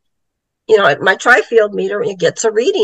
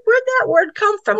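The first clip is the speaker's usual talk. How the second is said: puzzled exclamation; 12 semitones higher than usual. Retold or imitated. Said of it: "He goes".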